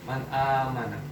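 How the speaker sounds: "Man amana" recited low, n unmerged; clear nun. Shown as a demonstration. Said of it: "Dibaca jelas nunnya".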